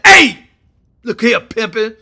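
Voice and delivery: loud, high "hey" to seize control; tough shouted phone greeting. Retold or imitated. Trump phones to stop a war, loud and commanding. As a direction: bark it down the line, then ease slightly.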